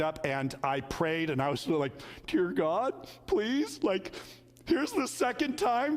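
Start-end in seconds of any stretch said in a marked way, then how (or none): 2.3-6.0 much higher, earnest begging to God; a pastor retelling his anxious prayer, pitched up and pleading. Retold or imitated. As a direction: plead with God, a little desperate.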